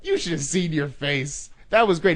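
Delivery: loud, high, gleeful just-kidding; snapping out of it. Retold or imitated. Prankster tells his victim he was kidding, excited and loud. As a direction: drop the act and rush out the joke. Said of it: "he just snaps right out of it".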